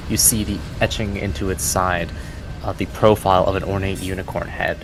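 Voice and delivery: ordinary talk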